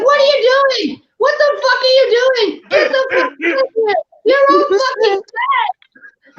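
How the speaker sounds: very high, squawking cartoon tirade; mock frantic yelling. Cartoon rescuer cursing at the kids, high mock frantic yelling. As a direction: scold them in a high, hammed-up panic.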